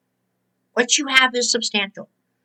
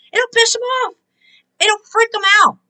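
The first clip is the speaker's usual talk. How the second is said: excited exclaim; 9 semitones higher, 5 dB louder than usual. Real, not acted. Exclaiming how gratitude angers enemy.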